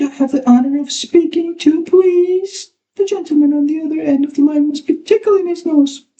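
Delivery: high affected phone voice; affected bedroom voice. Acted. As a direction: purr it like a seductive lady on the phone. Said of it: "in time bedroom voice".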